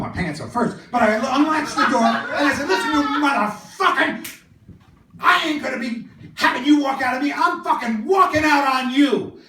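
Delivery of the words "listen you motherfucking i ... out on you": high, loud acted tirade; indignant walkout. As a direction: tell him off as you storm out.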